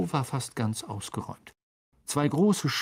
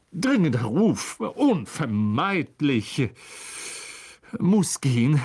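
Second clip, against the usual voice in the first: evasive excuse; slower than usual. A narrator's reading. Lockhart making excuses to flee.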